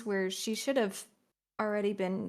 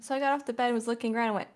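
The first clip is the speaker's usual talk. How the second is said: ordinary delivery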